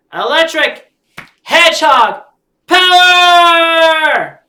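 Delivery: high, very loud transformation yell; battle cry. Acted. Hero calling a transformation, very loud shout. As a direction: shout the power-up phrase.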